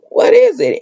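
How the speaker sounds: high, sudden "What is it?"; alarmed question. Retold or imitated. Chad startled at the tablet alert, pitched high. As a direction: blurt it out, pitched well up.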